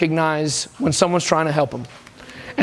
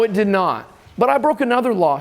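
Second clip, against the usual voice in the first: usual speaking voice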